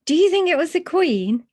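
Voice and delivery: high, loud eager question; can't wait to know if it was her. Acted. Bear asks Mister Gruber, high and eager. Paddington eager about the Queen.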